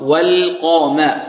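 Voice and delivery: "والقام" slow, full dark qaf into thin mim; heavy qaf, light mim. Shown as a demonstration. Teacher shows the contrast, heavy qaf in "والقام". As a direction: draw out the thick qaf of "والقام". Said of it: "مفخمة".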